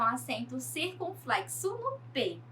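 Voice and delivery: ordinary talk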